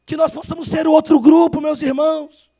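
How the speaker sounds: loud rising preacher's appeal; preaching at full force. Acted. Pastor voicing the master then calling the church, full-force preaching. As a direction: plead and build to the close.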